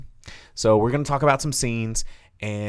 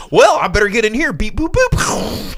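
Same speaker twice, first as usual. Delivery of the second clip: mocking Rey; 9 semitones higher, 5 dB louder than usual. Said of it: "and go".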